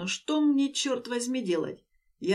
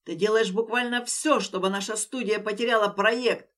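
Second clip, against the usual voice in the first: loud enraged yelling; angry shout; 4 dB louder than usual. Acted. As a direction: lash out in fury. Den rages at Leya.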